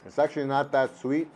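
speaking between bites; talking while chewing. Real, not acted. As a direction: mouth partly full. Seen 0: Mid-tasting, squinting with working jaw, almond held near mouth.

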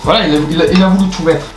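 teasing loud. Real, not acted.

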